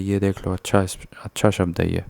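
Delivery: ordinary delivery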